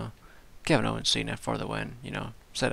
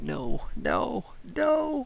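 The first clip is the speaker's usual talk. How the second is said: dismay; 14 semitones higher, 4 dB louder, much slower than usual. Retold or imitated. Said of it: "I was like".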